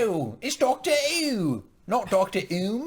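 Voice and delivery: put-on high, drawn-out silly English accent; hammed-up English caricature, loud and high. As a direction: stretch it out in mock English. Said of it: "Let me hear your best English accent".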